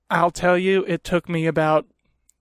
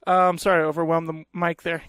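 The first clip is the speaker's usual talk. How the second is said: ordinary talk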